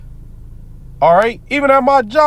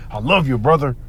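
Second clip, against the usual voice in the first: lightly voiced fake-sweet coworker, a bit lower; mock coworker; 5 semitones lower than usual. Mocking a coworker's fake love, lightly voiced lower. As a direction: imitate a coworker's hollow affection. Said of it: "Edomite talk like that".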